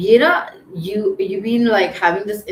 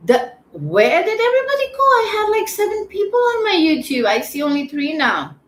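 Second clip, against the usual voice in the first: much higher, fast and louder, asking where everyone went; startled dismay; 12 semitones higher, 5 dB louder than usual. Real, not acted. Streamer asking his vanished YouTube viewers where they went, shocked and high. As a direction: shoot up in pitch, baffled that viewers vanished.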